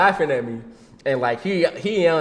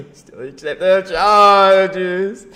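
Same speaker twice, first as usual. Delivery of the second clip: louder and higher, words stretched slowly; slow loud punchline; 5 semitones higher, 10 dB louder, much slower than usual. Acted. Laughing that the brother still accepted the calls, slow and loud.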